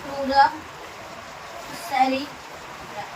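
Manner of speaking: word by word, with long pauses; slow dictation. Shown as a demonstration. Teacher models the midbrain's other name, slow dictation. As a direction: spell out "crura ... सेरिब्रा" slowly for repeating.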